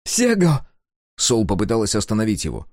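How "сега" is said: high, urgent call of his name; sharp call. A narrator's reading. Sol stopping Sega, high and urgent. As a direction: stop him with his name.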